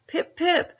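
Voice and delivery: put-on high, loud breathless boy; gasping. A narrator's reading. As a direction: gasp it out in a rush. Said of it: "he gasped".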